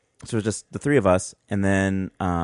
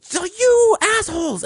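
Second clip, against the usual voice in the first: put-on very high, slow shrieking old lady; mock old lady yell. Imitating the soaked old lady at the teens, high shriek. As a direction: shriek it like an outraged granny. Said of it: "she's like".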